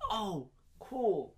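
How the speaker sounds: soft, underwhelmed reply; unfaked, lukewarm reaction. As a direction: take the gift with little enthusiasm. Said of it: "so I don't have to fake it".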